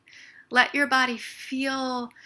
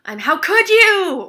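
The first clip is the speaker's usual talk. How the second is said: slow, loud, much higher accusation; angry outburst; 9 semitones higher, 16 dB louder, slower than usual. Shown as a demonstration. Calling up the upset while tapping, slow furious accusation. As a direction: drag it out in outrage. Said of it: "find the right words to call up the upset".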